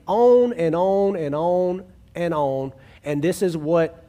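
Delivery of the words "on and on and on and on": drawn-out list. Real, not acted. Listing blame, drawn out.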